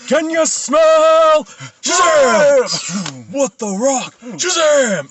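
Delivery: very loud, high, long-drawn wrestling catchphrase; The Rock. Acted. Joking friend answering criticism of the impression, booming wrestler catchphrase. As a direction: bellow it like a hammed-up wrestler, stretching every word. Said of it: "that's a terrible Rock impression".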